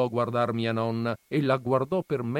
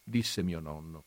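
ordinary delivery

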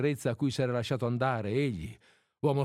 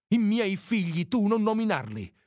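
high, sharp outburst of hurt anger; wounded cry; 8 semitones higher, 4 dB louder than usual. A narrator's reading. Mother forbidding her lover to name her children, wounded cry. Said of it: "gli gridò ella ferita".

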